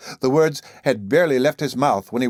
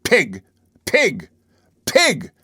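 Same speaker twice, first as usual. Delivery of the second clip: building from plain to a high, loud scream; each Pig louder and higher than the last; top of his lungs; 7 semitones higher, 3 dB louder, much slower than usual. A narrator's reading. Enraged boy screaming up at the captain, building to a shriek. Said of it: "he was reiterating at the top of his lungs".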